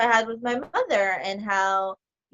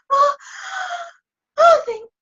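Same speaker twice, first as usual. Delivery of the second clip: imitating a sobbing caller; 8 dB louder than usual. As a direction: cry out in exaggerated relief. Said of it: "And she starts crying".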